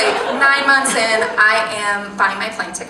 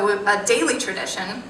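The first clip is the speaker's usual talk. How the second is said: ordinary delivery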